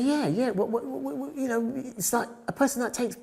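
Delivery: high, eager rush. Retold or imitated. Student eagerly checking what a fashion photographer is, high. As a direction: jump on the idea excitedly.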